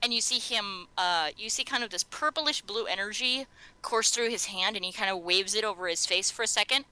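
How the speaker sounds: ordinary delivery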